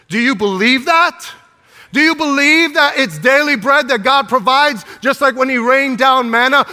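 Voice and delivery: high, loud, driving preacher's cry; preaching at full force. Acted. Pastor exhorting the church, fervent loud preaching. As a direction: build the fire and push it out.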